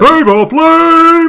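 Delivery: high, slow, shouted hype-man call; [name] shout. Retold or imitated.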